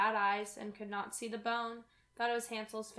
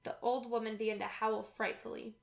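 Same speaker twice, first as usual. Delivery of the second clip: plain reading voice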